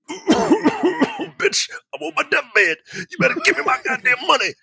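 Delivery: hammed-up deathbed scolding; dying father hurrying to demand his money; mock demanding father. Voicing greedy dying father.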